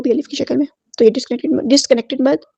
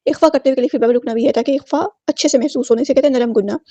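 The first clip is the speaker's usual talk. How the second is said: normal talking voice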